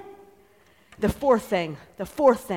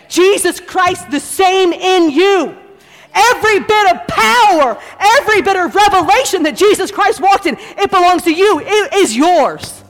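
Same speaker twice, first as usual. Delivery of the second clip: slow, raised, emphatic pulpit delivery; full-force preaching; 6 semitones higher, 4 dB louder, slower than usual. Real, not acted. Preacher telling congregation the power is theirs, full force. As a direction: drive each phrase home.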